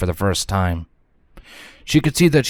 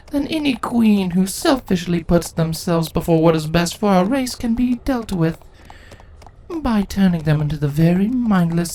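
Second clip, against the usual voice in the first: measured, cold queen explaining her plan; a hint of a ruthless ruler justifying herself; lightly voiced scheming queen; cold changeling queen; 9 semitones higher than usual. Crudellis speaks as queen.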